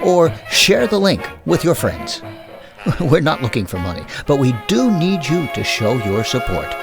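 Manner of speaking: ordinary delivery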